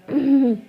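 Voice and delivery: pointed "ahem"; deliberate little cough; attention-seeking throat clear. Acted. Acted bid for attention.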